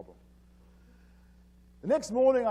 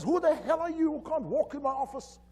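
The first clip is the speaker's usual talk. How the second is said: high-pitched angry boss; retold yelling; 10 semitones higher than usual. Boss challenging the stranger who walked in, yelling. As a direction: demand it in a high, indignant outburst. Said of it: "he yells at me".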